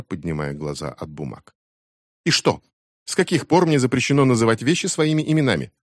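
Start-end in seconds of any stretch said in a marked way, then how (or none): 2.2-5.7 higher, defiant challenge; a girl defying her mother and aunt; defiant teen. A narrator's reading. Becky defies the adults. (дерзкий вызов, выше обычного)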